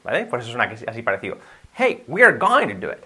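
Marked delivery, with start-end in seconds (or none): 1.8-3.0 higher, linked "going to" as near "gonna"; natural reduced "going to", peaking high; almost gonna. Shown as a demonstration. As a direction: call out "Hey", then run "going to" together. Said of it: "De esa forma sería".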